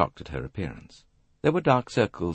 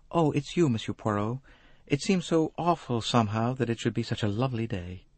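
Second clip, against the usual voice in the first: lightly voiced, slightly higher female figure; wistful young woman; 5 semitones higher than usual.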